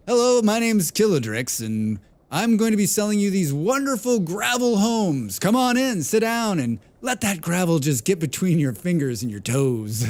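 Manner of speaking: high, loud, hammed-up pushy salesman; salesman pitch. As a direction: oversell the gravel homes like a cheesy salesman. Mock gravel home sales pitch.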